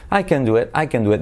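quick, with CAN reduced to "ken"; said quickly. Shown as a demonstration. Teacher showing the fast version, said quickly. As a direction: run it together, short "eh" in CAN. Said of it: "dicho rápido".

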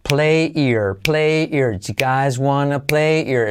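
"play ear" for play here, linked without H; skipped H. Shown as a demonstration. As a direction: glide from PLAY straight into EAR. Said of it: "Saltamos la H".

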